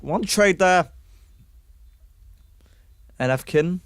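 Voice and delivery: fast, lively esports commentary; excited caster calling a round; play-by-play casting. Acted. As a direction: call the action as it happens.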